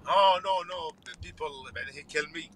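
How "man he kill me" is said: ordinary talk, nothing marked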